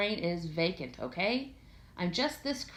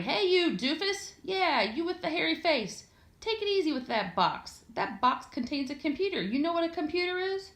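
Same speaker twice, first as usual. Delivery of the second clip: put-on bossy kid yelling; 9 semitones higher than usual. A narrator's reading. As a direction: yell up at them like a cocky small boy. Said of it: "yelling up at the movers".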